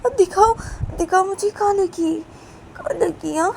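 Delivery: high, loud worried asking; worried woman checking a hurt child; anxious concern. Acted. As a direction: ask urgently where it hurts. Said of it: "बोलती कि".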